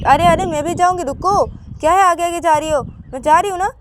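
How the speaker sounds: high, loud protest; indignant calling out. Acted. Ananya calls to Payal walking ahead, loud indignant protest. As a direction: call after someone walking off without you.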